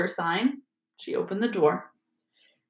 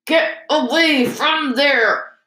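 high, slow, swelling command; forceful scolding order; 6 semitones higher, 4 dB louder, much slower than usual. A narrator's reading. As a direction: order them off like a bossy elder brother. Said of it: "Percy swelled in a manner".

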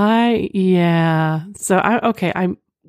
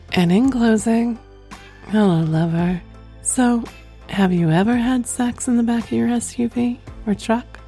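breathy vintage purr; 3 semitones higher than usual. Acted. Sultry podcast sign-off.

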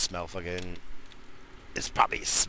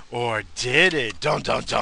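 dramatic suspense; 8 dB louder, slower than usual. Pretend. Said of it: "I'm just kidding".

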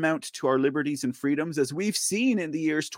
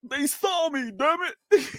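mock whiny panic; 12 semitones higher than usual. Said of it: "He was like".

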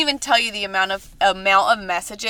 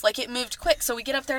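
ordinary delivery

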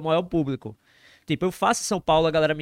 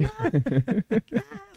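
high laugh; 10 semitones higher, 3 dB quieter than usual. Real, not acted. Laughs after retelling the scream.

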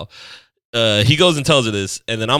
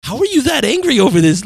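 incredulous; 10 semitones higher, 4 dB louder than usual. Retold or imitated. Baffled reaction to her outburst.

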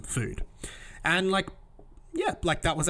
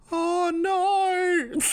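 hammed-up old lady crying out, high and loud; goofy old woman's wail; 5 dB louder than usual. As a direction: imitate a loud, high-pitched wail of dismay. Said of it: "just heard her go".

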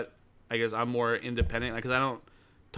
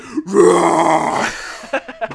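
put-on loud howling child scream; demonic scream; 4 semitones higher, 10 dB louder than usual. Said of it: "a little demonic boy".

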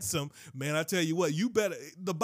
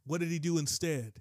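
usual speaking voice

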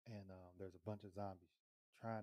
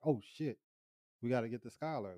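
retold alarmed realisation; 3 semitones higher, 10 dB louder than usual.

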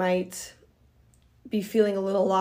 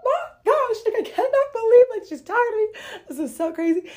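very high, loud, slowed squeal of disbelief; retold fangirl squeal; giddy squeal; 5 dB louder than usual. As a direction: squeal like a starstruck fan, then come down. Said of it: "I was like".

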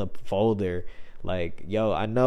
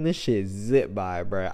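ordinary talk, nothing marked

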